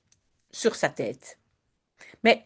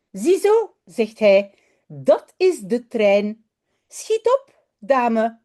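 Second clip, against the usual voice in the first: expressive read-aloud with voiced characters; storybook narration, lively; children's story reading; 3 semitones higher, slower than usual. A narrator's reading. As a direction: read it to a class of little ones.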